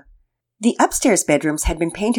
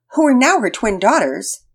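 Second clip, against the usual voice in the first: ordinary delivery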